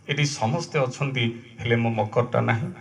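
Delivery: low, hushed lament in verse; sorrowful refrain. Acted. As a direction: drop low and soft, grieving the absent friend. Poem's refrain of loss. (ଧୀର, ଦୁଃଖଭରା ସ୍ୱରରେ)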